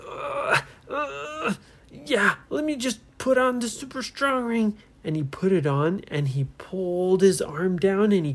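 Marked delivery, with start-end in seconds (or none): 0.1-1.6 high, effortful "ugh"; straining grunts. Acted. Trapped boy struggles to reach. 1.9-4.8 high, squeezed struggling voice; strained with effort. Acted. Trapped boy speaks while struggling.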